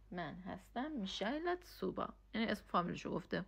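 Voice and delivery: ordinary talk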